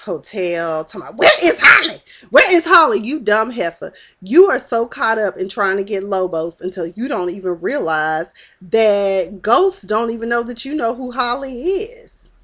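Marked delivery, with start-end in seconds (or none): 1.2-2.0 shrill, loud furious demand, much higher; retold furious shout at Ghost; angry demand. Angela confronts Ghost at hotel. 2.3-3.0 loud, high furious repeat; louder furious yell, much higher; angry demand. Retold or imitated. Angela confronts Ghost at hotel. 3.0-12.3 imitating a scornful scolding; scolding insult. Angela insults Ghost.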